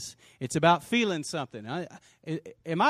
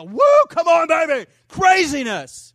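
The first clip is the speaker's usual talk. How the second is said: much higher and louder, slowed shouting; fight fan cheering; 12 semitones higher, 10 dB louder than usual. Retold or imitated. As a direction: yell at the fight like a hyped fan. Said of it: "you'll be a".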